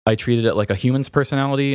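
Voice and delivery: usual speaking voice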